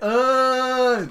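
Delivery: loud high call across the warehouse; yelling across the room. Retold or imitated. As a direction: shout the nickname down the aisle. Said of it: "just be like".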